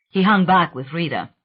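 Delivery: ordinary delivery, nothing marked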